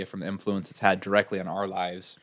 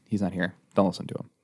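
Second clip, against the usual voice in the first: normal talking voice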